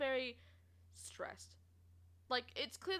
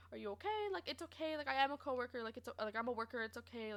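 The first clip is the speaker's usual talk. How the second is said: soft, gentle reassurance; hushed and careful, retold comforting; 6 dB quieter, faster than usual. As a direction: reassure softly, as if to someone upset. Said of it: "I made sure to talk in a soft tone".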